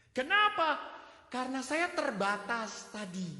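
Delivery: loud, high fervent sermon voice; preaching at full force. Acted. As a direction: preach it out to the whole church. Preaching to congregation. (khotbah berapi-api, keras dan tinggi)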